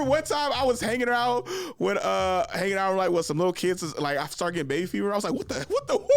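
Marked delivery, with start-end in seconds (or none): 5.3-6.0 very high squeak of disbelief; high startled squeal at himself; shocked. Retold or imitated. Inner voice shocked by baby fever.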